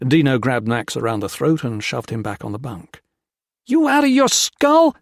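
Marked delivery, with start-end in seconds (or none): 3.6-4.9 very high, loud gasped cry; gasped shout. A narrator's reading. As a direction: gasp it out in shock at full volume. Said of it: "Dino gasped".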